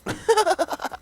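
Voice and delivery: high, loud burst of laughter. Real, not acted. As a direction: crack up at the comeback. Laughs at the comeback.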